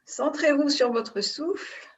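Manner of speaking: ordinary talk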